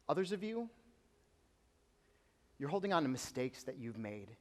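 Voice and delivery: gentle sermon appeal; quiet pastoral appeal. Acted. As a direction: lean in and speak softly to the hurting. Soft preaching application.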